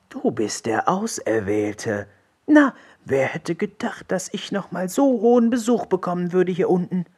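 creature voice rising high in wonder; disbelieving troll frog. A narrator's reading. Healed frog greets his lofty visitor, amazed. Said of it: "sagte der Trollfrosch etwas ungläubig".

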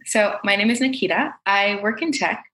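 ordinary delivery

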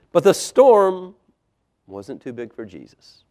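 punched key words, softening at phrase ends; emphatic story narration. A narrator's reading. Storyteller telling children of fishermen in a storm, emphatic narration. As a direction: stress the struggle, then settle down.

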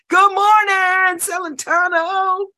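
high, drawn-out shout to a crowd; hollered greeting. Retold or imitated. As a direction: holler it out like a rousing morning greeting. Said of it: "jump on and say".

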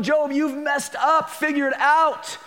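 high, slow mock scolding; mock God scolding. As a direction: play God chiding Job, drawn out. God voiced scolding Job.

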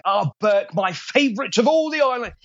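high, grand Cockney-English salesman greeting; Trader Johann's Cockneyish English voice. Acted. Voice actor demos his Cockneyish English salesman character, high and grand. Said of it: "grand salesman of sorts, kind of Cockneyish, kind of English".

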